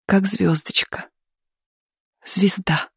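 whispered endearment; tender hush. A narrator's reading. Mother softly admiring daughter.

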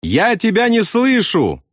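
high, drawn-out shout at moderate volume. A narrator's reading. Bombardier answers pilot over engines, high shout. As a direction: call back on a high held note.

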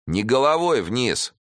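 a cruel henchman correcting others; rough thug. A narrator's reading. Russian thug tells the gang how to drop him, roughly. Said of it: "сказал русский".

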